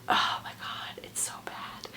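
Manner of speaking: hushed whisper, breath only. Real, not acted. Vlogger whispering to viewers how bad the book is. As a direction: whisper it like a guilty confession. Disgusted aside about the book.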